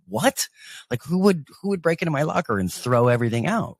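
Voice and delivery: high-pitched, disbelieving outburst; incredulous. Retold or imitated. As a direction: retell stunned disbelief, voice jumping up. Retold shock at trashed locker.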